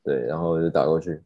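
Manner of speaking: normal talking voice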